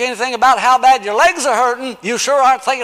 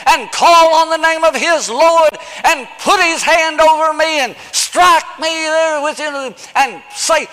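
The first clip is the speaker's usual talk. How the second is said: indignant Naaman; 7 semitones higher, 4 dB louder than usual. Retold or imitated. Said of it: "That's what Naaman said".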